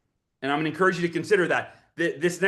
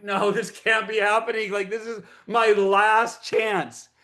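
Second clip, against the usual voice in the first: retold disbelief, higher pitch, slowing into despair; sinking dismay; 4 semitones higher, slower than usual. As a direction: protest as your last hope slips away. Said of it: "my heart just sinks into my stomach".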